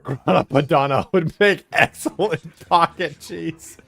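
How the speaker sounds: laughing. Real, not acted. Laughing at pocket cheese joke.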